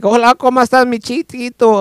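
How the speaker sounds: put-on high-pitched Cuban-accented come-on; flirty Cuban man. Cuban man hitting on him outside, flirty Cuban accent. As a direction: put on a Cuban man's flirty Spanish lilt. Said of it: "Cuban guy".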